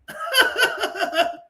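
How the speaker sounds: high cackle at the streamer's clip; high laugh. Real, not acted. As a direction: burst of high-pitched laughter.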